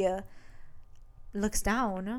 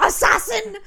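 high, loud cry with a laugh in it; mock scream; 13 semitones higher, 12 dB louder than usual. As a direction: yell it like an alarmed onlooker, half laughing. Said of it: "she just hears fucking screaming".

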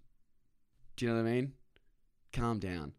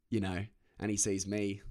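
usual speaking voice

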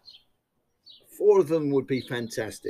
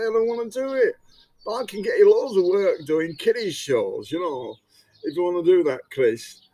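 put-on Lancashire accent, raised and louder; northern Lancashire accent, lightly put on; A J's Lancashire accent; 5 semitones higher, 6 dB louder than usual. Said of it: "I'm going to have to do A J's Lancashire accent".

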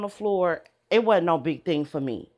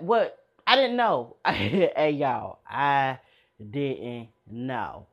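ordinary talk

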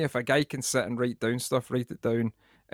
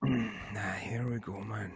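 whispered dread; 3 semitones lower, 8 dB quieter, much slower than usual. Real, not acted. Dreading the face mask article.

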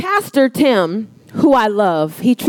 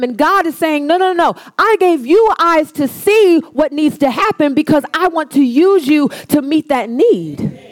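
preaching; 3 semitones higher than usual. Acted. Preaching builds in force.

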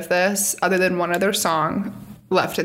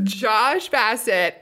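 ordinary talk

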